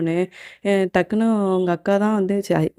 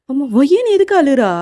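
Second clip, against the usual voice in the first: louder, high-pitched worried question; alarmed questioning; 7 semitones higher, 6 dB louder than usual. Acted. Uncle demanding to know why she is crying, alarmed. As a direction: burst out asking, higher and louder.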